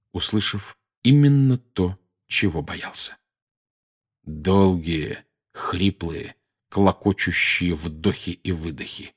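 plain reading voice

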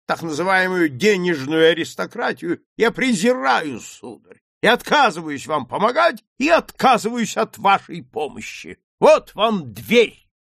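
loud, high, emphatic outrage; scornful, ringing refusal; indignant dismissal. Acted. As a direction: declaim it like throwing someone out. Indignant character throws visitors out.

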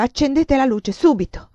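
urgent demand. Acted. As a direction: demand it now, frantic. (ordine concitato)

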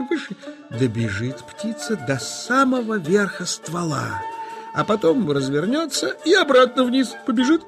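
ordinary talk, nothing marked

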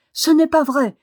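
high, loud indignant outcry; indignation violente. A narrator's reading. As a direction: fling it back, pitched high and loud. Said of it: "dans la violence de son indignation". (cri indigné, aigu et fort)

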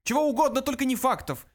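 very high, loud outburst; breathless shout. A narrator's reading. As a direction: cry out in frantic protest. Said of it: "едва переведя дух, закричал Врумфондель".

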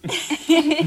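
high, forced burst of laughter; awkward high chuckle; nervous laugh. Acted. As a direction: laugh it off to cover nerves. Nervous host laughs at producer's joke.

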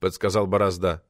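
usual speaking voice

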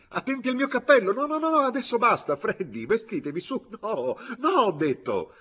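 high, fast, loud protests; exasperated high-pitched shouting; frantic shouting. A narrator's reading. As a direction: yell in a flustered panic, voice pitched right up. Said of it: "gridò il signor Bibb".

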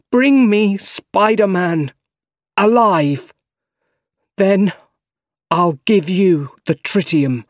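high, loud, slow calling out; desperate bargaining. A narrator's reading. Harry bargaining with Doc Ock for his life, desperate. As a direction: call out each phrase, urgent and high.

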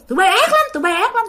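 high-pitched angry accusation; scolding curse. Acted. Possessed grandfather cursing at grandma, scolding. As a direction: rail at them in a high voice. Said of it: "cứ chửi các bạn".

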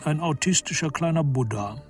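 ordinary talk

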